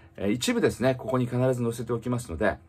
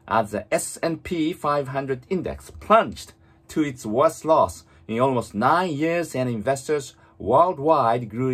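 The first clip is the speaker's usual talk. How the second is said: very slow, fully articulated English reading; read-aloud model; 2 semitones higher, much slower than usual. Shown as a demonstration. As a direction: move the lips fully, reading slowly for shadowing. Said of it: "本当に大きな声で唇を思いっきり動かして". (口を大きく動かしてゆっくり音読)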